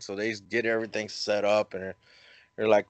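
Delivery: ordinary delivery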